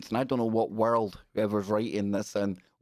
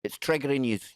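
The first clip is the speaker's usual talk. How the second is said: ordinary talk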